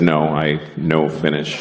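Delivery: low, slow, deliberate reply; measured, understated deadpan; deadpan broken English. Acted. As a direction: answer earnestly in broken English. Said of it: "repeat people's bad English to them".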